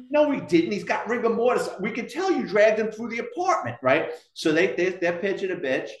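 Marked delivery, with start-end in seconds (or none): 0.1-3.8 high-pitched, insistent contradiction; indignant retort. Retold or imitated. EMTs accusing the cop of lying, indignant. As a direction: imitate someone calling out a blatant lie. EMTs reject cop's lie, retold argument.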